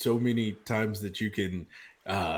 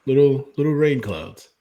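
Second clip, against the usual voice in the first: fond teasing; 6 semitones higher, 10 dB louder than usual. Acted. Teasing captain about her blush.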